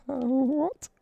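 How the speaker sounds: high, loud startled yelp; mock startled. Caught passing notes, answers the teacher in a high startled yelp. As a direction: play it caught out, squeaking in shock.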